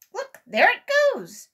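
high and loud pointing cry; alarmed shout. A narrator's reading. Sammy watching the airship fly away, alarmed.

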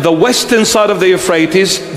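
ordinary talk

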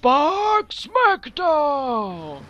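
slow, booming ring announcer call; wrestling promo. Pretend. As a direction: stretch it out like a WWF announcer. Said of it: "Das klingt wie ein Wrestler-Move irgendwie".

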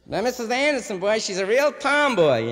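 high gossipy townswoman voice; chatty neighbor talk; gossiping neighbor. Retold or imitated.

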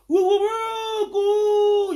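high, slow crowing like a rooster; cock crow imitation. Mocking a rival by imitating a rooster's crow. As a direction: crow it out like a cockerel. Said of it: "cock dey crow".

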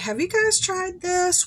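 high bright question to strangers; lightly voiced self asking other shoppers. Vlogger retelling asking two shoppers, pitched high. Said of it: "I was like".

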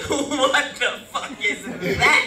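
slow, drawn-out baffled question; mock shocked. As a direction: act stunned, stretching it out. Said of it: "they're like".

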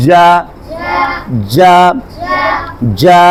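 firm, loud syllable "যা" on a level pitch; loud recitation. Shown as a demonstration. Teacher telling pupils to read loudly, loud drill delivery. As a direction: call out "যা" for pupils to repeat. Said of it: "জোরে পড়ো".

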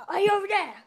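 high, loud shout into the distance; calling out. Pretend. Searching for a missing friend, loud high call. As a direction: holler it out to someone hidden.

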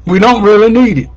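high, loud and fast in one breath; quick, emphatic. Real, not acted. Preacher tells congregation what is unnecessary, quick and emphatic.

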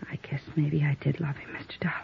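soft, almost whispered admission; hushed confession. Acted. Widow confessing to Mister Dollar, barely above a whisper. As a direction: let it slip out under your breath.

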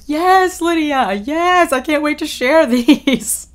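high, loud excited cheer; cheering to a friend over great photos; excited squeal. Retold or imitated. Cheering to friend Lydia about the photos, high and excited. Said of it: "I was like".

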